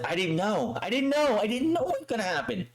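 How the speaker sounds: high, hurried mock protest; mock panicked pleading. Manager protesting innocence to his angry client, mock panicked. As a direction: imitate a man pleading ignorance, pitch climbing. Acting out Heyman's panicked excuse.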